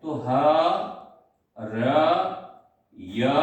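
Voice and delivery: ह, र, य said apart, each syllable alone with pauses; teacher splitting the word into letters; spelling out syllables. Shown as a demonstration. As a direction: break the word into ह, र, य. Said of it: "ए सूत्र कैसे बनाएंगे इसको".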